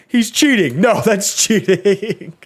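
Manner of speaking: high screamed protest, stretched out; Ludwig screaming. Retold or imitated. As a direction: scream it like a cheated gamer. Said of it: "Ludwig screaming".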